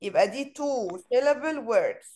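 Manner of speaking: usual speaking voice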